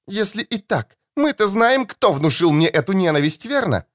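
put-on high, shrill-free angry young woman's cry; furious daughter. Daughter accuses.

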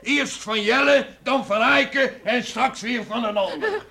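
high, loud, sneering with a bitter laugh; mock her changing story; scornful outburst. Father mocking his daughter's new confession.

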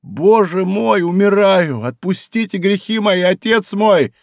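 high, anguished dying plea; мольба умирающего. A narrator's reading. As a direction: cry out desperately for absolution. Dying executioner begs for absolution. (отчаянная мольба умирающего)

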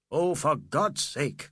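slow, exasperated cry. A narrator's reading. Fielding as his nerves break under the contagion, crying out. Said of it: "cried Fielding".

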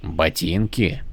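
low, slow, puzzled question; lost for words; baffled soldier. A narrator's reading. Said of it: "наконец переспросил".

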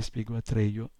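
ordinary delivery

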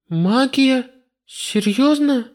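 high disbelieving question; incredulous. Acted. Heroine incredulous.

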